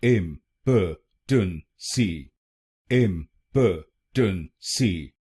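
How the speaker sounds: इम्पोटेंसी slowly split as im, puh, tun, see; syllable by syllable. Shown as a demonstration. Teacher drilling four syllables, slowly separated. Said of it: "इस शब्द में चार शब्दांश हैं".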